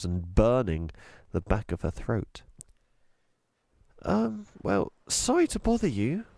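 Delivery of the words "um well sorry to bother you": put-on nervous girl. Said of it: "Chloe went on nervously".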